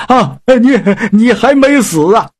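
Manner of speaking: a startled, alarmed pig demon. Acted. Pig demon startled by the voice in his belly, alarmed. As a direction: blurt it out in fright.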